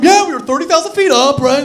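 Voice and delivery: loud, high, cheery survivor tale; mock survivor bravado. Comic mocking an imagined plane-crash survivor, loud and cheery. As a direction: brag about it like it was nothing. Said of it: "walking away from".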